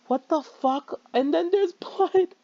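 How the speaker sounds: usual speaking voice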